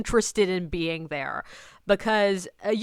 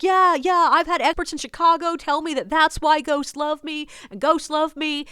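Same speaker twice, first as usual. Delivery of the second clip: high, loud, going on and on; insistent stranger; 6 semitones higher, 5 dB louder than usual. Retold or imitated. Stranger boasting to the tour guide, insistent. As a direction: imitate a man who won't let it drop. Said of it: "he keeps going on and on".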